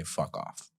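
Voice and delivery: usual speaking voice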